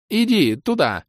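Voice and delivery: very high, insistent order; high command. A narrator's reading. Elf sends the demoness off, high and insistent.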